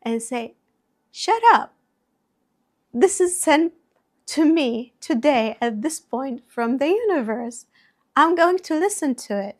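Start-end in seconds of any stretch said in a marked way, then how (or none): 1.1-7.6 loud, high-pitched outburst, retold; firm, raised voice, acting out defiance; emphatic retort. 8.1-9.6 loud, high, quick declaration; raised-voice resolve; determined retort. Retold or imitated. Retold retort to the inner voice.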